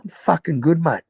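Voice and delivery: high mock cocky voice; Neville. Neville boasting to Chopper, high cocky put-on voice. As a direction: play a limping crook bragging he's flying, voiced high. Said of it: "And he goes".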